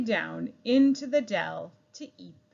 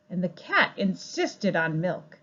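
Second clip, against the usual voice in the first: usual speaking voice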